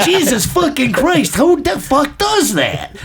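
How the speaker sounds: slow, high, drawn-out exasperation; retold exasperated yell. Buddy scolding his friend on the street, in slow, high exasperation. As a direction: stretch each word out in high disbelief. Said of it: "yelling at him".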